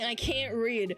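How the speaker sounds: normal talking voice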